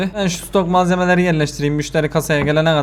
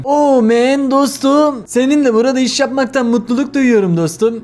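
higher, slow laid-back dude voice; cheerful dude customer; 5 semitones higher than usual. Acted. Gamer voicing the friendly customer at the till, laid-back dude. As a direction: drawl it like a happy regular.

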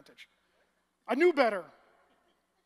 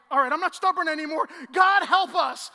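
loud, higher desperate plea to God; panicked cry; 5 semitones higher, 15 dB louder than usual. Retold or imitated. Preacher retelling the storm, crying out loud to God. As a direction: yell to heaven as the waves grow.

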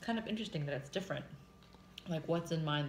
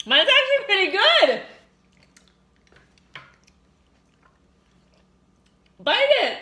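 much higher and louder, thrilled; excited; 16 dB louder than usual. Real, not acted. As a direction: burst out with delight at the taste. Tasting pickle pop, excited.

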